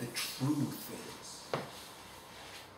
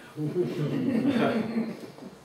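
laugh; 8 semitones higher than usual. Real, not acted. Laughing after punchline.

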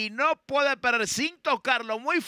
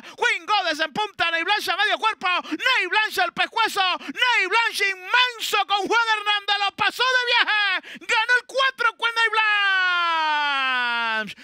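excited race call; 8 semitones higher than usual. Real, not acted. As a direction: build to the winner, shouting.

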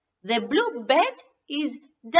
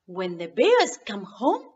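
ordinary delivery

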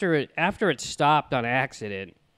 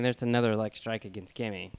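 normal talking voice

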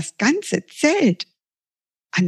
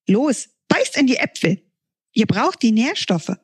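much higher, bubbly little sun creature; sun sprite; 5 semitones higher than usual. Acted. The sun creature urges the kids to eat apples, higher bubbly voice. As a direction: chatter like an excited sprite.

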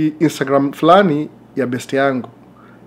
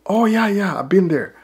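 high-pitched, imitated "oh, yeah" enthusiasm; mock-impressed, voice leaping up then easing down; enthusiastic recognition; 7 semitones higher than usual. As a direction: act out a stranger who knows the place. Retells stranger's delighted recognition of Kenya.

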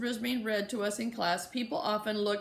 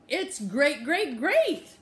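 high squeaky rising, louder; excited hamster squeak; 5 semitones higher, 6 dB louder, much slower than usual. Acted. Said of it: "I squeaked".

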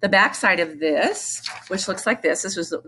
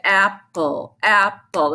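"apple" split into two spaced beats; syllable by syllable; much slower than usual. Shown as a demonstration. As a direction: say "apple" slowly, one part at a time. Rhythm syllables demonstrated slowly.

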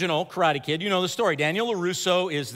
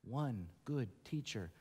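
soft, intimate, earnest preaching; quiet sermon appeal; 4 semitones lower, 13 dB quieter than usual. Real, not acted. A pastor urges the congregation to trust Jesus, softly and earnestly. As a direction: draw the congregation in close.

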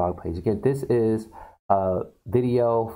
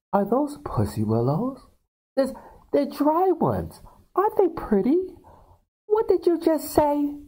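high prim falsetto lady, slowing on "dry ones"; mock genteel hostess, shocked at the end; falsetto sweet hostess. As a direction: gush prim and high about the flowers.

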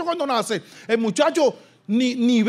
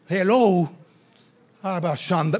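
ordinary talk, nothing marked

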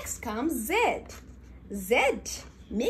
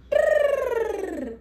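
elephant trumpet; 11 semitones higher, 6 dB louder than usual. Shown as a demonstration.

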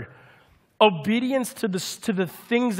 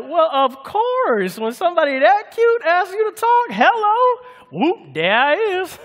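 much higher and louder, won over; delighted dad; 10 semitones higher, 5 dB louder than usual. Retold or imitated. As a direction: gush like a dad melted by cuteness. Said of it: "I'm like".

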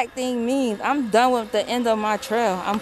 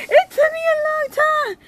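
much higher, squealing repeat after laughing; high-pitched wail; 3 dB louder than usual. Real, not acted.

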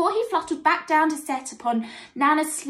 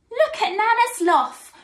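high, jeering call; mocking, amused armadillo; Roger; 8 semitones higher, 4 dB louder than usual. A narrator's reading. Roger laughs at Nana Sloth as he shuffles by, teasing. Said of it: "laughed Roger the armadillo".

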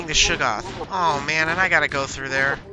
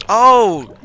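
startled; 5 semitones higher, 10 dB louder than usual. Real, not acted. Startled by something in game.

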